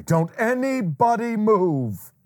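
high, very slow, dramatic dad warning; Christmas Story dad. Retold or imitated. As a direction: freeze everyone like a panicked father. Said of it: "This is like the father from A Christmas Story".

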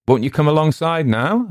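steady hail, rising at the end; even voice. A narrator's reading. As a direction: call an invitation out evenly. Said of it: "asked Will in an even voice".

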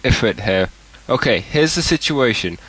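ordinary delivery

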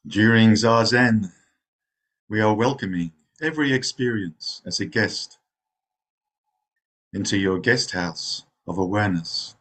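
unhurried meditation guide, long pauses between phrases; guided meditation. Real, not acted. Zen teacher settling meditators into rest, guided meditation voice. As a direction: ease them into rest, phrase by phrase.

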